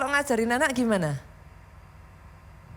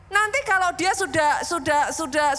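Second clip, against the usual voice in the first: ordinary delivery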